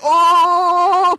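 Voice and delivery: a drawn-out loud "aah"; long loud ah. Acted. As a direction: stretch out a long loud cry. Dramatic postmodern exclamation.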